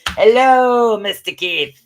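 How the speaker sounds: loud, high, drawn-out hello; calling out a greeting. Real, not acted. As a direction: greet a new arrival at full volume. Greets a viewer joining the stream.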